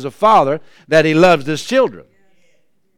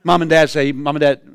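normal talking voice

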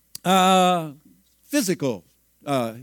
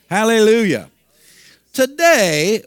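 loud, higher exclamation; loud hallelujah; 5 semitones higher, 7 dB louder, slower than usual. Real, not acted. Preacher shouts praise to the congregation, loud and raised. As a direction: cry it out in praise.

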